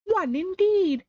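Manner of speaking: high, slow indignant scoff. A narrator's reading. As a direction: huff it out slowly, high up. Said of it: "said the Dormouse indignantly".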